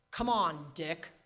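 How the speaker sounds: put-on mild New England accent. Grandmother nudging her husband, mild New England accent. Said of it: "New England [name] accent".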